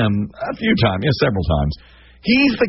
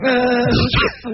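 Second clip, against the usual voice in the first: stalling; 13 semitones higher than usual. Retold or imitated. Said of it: "And I'm like".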